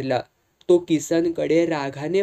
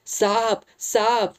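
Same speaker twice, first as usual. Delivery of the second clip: acted fright, calling out in alarm; terrified cry; 5 semitones higher, much slower than usual. A narrator's reading. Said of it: "ते भेदरून ओरडले". (घाबरून मारलेली हाक, वरच्या पट्टीत)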